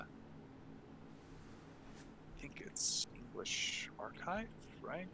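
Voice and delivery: a quick whisper under his breath; whispered aside. Real, not acted. Looking up a website, he whispers the name to himself. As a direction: whisper it to yourself as you look it up.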